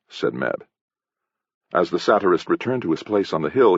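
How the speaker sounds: plain reading voice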